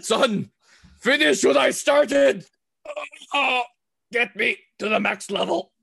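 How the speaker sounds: dying parent, slow and high; hammy deathbed plea to his son; overacted death scene. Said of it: "pass it on to your child".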